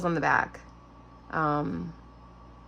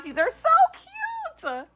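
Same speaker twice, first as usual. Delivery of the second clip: 1 excited coo; much slower than usual. Real, not acted. Gushing over handmade cards.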